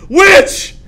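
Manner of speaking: high, loud cry of accusation; hammed-up alarmed yell; acted accusing shout. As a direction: shout it like a witch-hunter pointing someone out. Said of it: "it'd be like".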